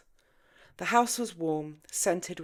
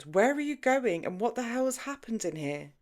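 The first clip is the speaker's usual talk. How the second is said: sharp, higher demand; a sharp, raised challenge; sharpened voice; 7 semitones higher, 4 dB louder than usual. A narrator's reading. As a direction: cut in hard and demanding. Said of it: "James' voice sharpened".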